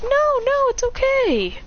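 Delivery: very high, sweet feminine voice; put-on high voice, gently dismissing an apology; reassuring mother figure. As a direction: soothe a child in a high pitch. Kushina reassures a child.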